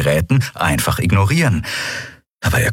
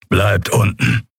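order; 2 semitones higher, slower than usual. Acted. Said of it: "befahl Kero".

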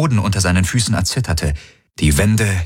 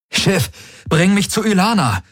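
high, urgent shouted order; urgent command; 12 semitones higher than usual. Acted. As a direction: call out for Ylana desperately. Desperate order to the ship. (dringender Befehl)

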